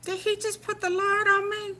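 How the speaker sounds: high, disbelieving question; can't believe he said that; incredulous. Real, not acted. Incredulous at son's comeback.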